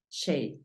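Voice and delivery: shape said as English sheip, long ei and a soft sh; English pronunciation. Shown as a demonstration. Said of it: "la pronunciación de esta palabra es shape".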